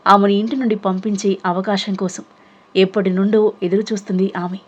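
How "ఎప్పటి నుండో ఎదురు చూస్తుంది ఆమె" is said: ordinary delivery, nothing marked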